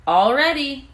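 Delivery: high, loud call of readiness; cried out. A narrator's reading. Stuart calling up to Margalo, high and loud. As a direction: sing it out, ready to go. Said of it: "he cried".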